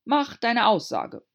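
slow, loud angry command; angry king. A narrator's reading. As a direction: repeat it angrily. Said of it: "wiederholte der König ärgerlich".

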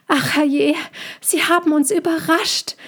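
high, odd little voice calling down in dismay; a startled creature crying out from a treetop. A narrator's reading. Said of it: "rief eine Stimme".

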